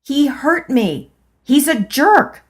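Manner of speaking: high, loud, hurt and angry; tearful outburst. A narrator's reading. Woman shouting her hurt at the old women. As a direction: cry it out in protest. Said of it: "now there were tears in my eyes".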